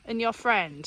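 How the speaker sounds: ordinary delivery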